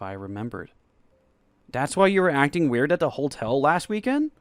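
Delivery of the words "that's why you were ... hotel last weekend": louder, higher, stunned question; stunned realisation. Acted. Narrator realising why boyfriend acted weird, stunned. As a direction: it suddenly clicks, ask in disbelief.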